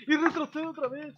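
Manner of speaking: high, loud disbelief; rising exasperated yelp; exasperated outburst. Real, not acted. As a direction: cry out as it flinches yet again. Pokémon flinches again.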